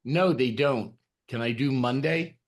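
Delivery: ordinary delivery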